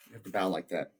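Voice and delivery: usual speaking voice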